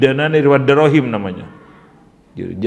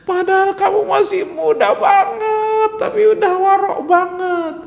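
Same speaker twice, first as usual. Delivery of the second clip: tearful praise; 3 dB louder than usual. Retold or imitated. Old man praises, high and tearful.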